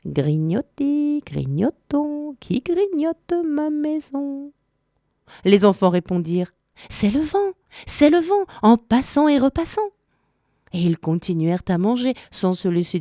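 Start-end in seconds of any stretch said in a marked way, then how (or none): 0.1-4.5 soft lilting sing-song, slowly drawn out, climbing high; sweet, gentle voice. A narrator's reading. Witch calling to the nibblers from her room, sweet sing-song. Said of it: "une douce voix qui venait de la chambre". 6.9-9.9 put-on high, lilting child-like answer; children's voices. A narrator's reading. Children fibbing to the voice inside that it's the wind, high lilt.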